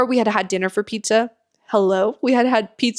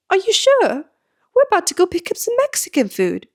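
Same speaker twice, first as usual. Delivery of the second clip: much higher, eager British impression; imitated British accent; 11 semitones higher than usual. Staff pressing client about lunch, high mock British accent. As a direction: coax in a high put-on British voice. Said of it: "if I can do the accent".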